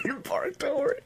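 breathy high whisper. Real, not acted. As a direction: whisper it back in disbelief. Echoing the phrase in disbelief.

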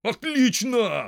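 high, loud, drawn-out exclamation; delighted cry. A narrator's reading. Farmer hearing of the uprising, delighted cry. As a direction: cry out slowly with full approval. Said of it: "вскричал фермер".

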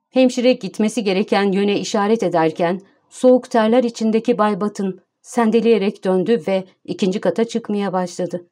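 ordinary delivery, nothing marked

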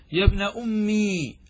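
slow demonstration of أمي with a full long ee; retained final ya. Shown as a demonstration. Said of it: "أثبت الياء ولا حذفها؟".

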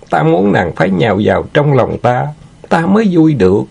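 high, self-satisfied leer; lecherous villain, gleeful. Acted.

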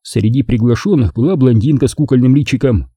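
plain reading voice

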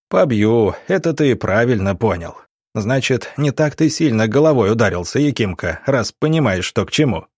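plain reading voice

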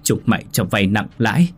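put-on old mother snapping with her last breath; defiant, pitch climbing high and loud by the end; angry snapping. A narrator's reading. Mother vowing to report her son's crimes, angry snapping. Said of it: "dùng chút hơi tàn mà gắt lên".

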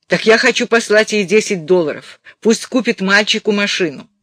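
a hint of a pushy mother; chatty insistent mother. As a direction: prompt his memory insistently. Reader plays mother.

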